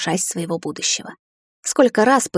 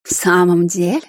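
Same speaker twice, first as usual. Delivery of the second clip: purring. A narrator's reading. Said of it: "мурлыкнула моя мать".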